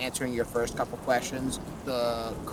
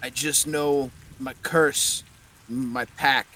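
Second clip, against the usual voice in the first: put-on Russian accent; 6 dB louder than usual. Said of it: "I'm doing a Russian accent now".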